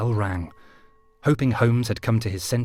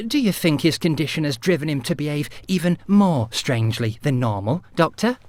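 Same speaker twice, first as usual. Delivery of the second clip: trembling lip; 5 semitones higher, 4 dB louder than usual. A narrator's reading. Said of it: "with a trembling lip".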